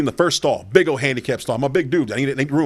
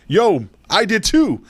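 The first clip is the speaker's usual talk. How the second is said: retold amazed confession; 8 semitones higher, 4 dB louder, much slower than usual.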